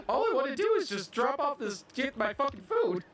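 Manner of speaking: high-pitched mock-pleading parody; exasperated Walker. Friend parodying Walker's quest for food, exasperated. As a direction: whine it high like a hammed-up hobbit. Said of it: "And then Walker's just".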